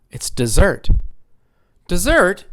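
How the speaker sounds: dessert with ZERT punched; second-syllable stress. Shown as a demonstration. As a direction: say dessert as di-ZERT, leaning on the end. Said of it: "it's on the second syllable".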